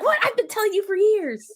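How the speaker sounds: high, exasperated mock mom. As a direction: play the fed-up mother. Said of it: "You'll be like".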